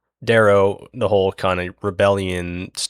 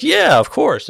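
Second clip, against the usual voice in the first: high, louder breezy of course; casual of course; 7 dB louder, slower than usual. Retold or imitated. Rock cheerfully admitting the nukes to the Ash Lord, high and breezy. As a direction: toss it off like it's obvious, pitched way up. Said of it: "Rock's like".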